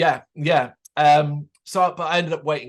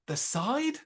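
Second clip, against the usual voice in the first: high, thrown question; baffled; 9 semitones higher, 4 dB quieter than usual. Retold or imitated. To the tour manager, baffled and high. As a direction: sound completely lost at the idea. Baffled at being sent round.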